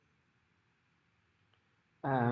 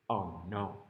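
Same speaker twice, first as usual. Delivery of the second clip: dismayed; 6 semitones lower, 4 dB quieter than usual. Acted. Storyteller dismayed at the sign.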